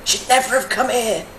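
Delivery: lightly put-on game-enemy threat; mock the attacker's warning from Skyrim; Skyrim enemy taunt. Streamer repeating a Skyrim enemy's line, mock threat. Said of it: "Skyrim flashbacks".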